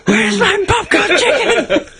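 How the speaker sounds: very high, slow, put-on laughing voice; silly customer voice. Mocking the chicken fight, high slow cartoon voice. Joking KFC scene.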